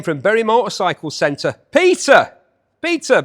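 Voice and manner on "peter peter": loud, high call to a pal; hearty shout of a name. Real, not acted. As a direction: sing out the name, pitched up high. Calls out to his pal Peter.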